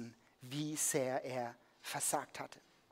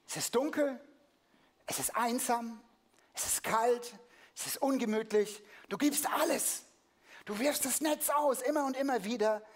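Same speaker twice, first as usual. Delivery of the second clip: impassioned preaching; 8 semitones higher than usual. Acted. Preacher painting the cold empty night, swelling preaching. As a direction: pile up the images, pitch climbing.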